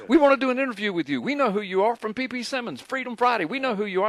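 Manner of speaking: lightly voiced excited newsman; quoted eager reporter. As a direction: rush it like a keen reporter. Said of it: "they say".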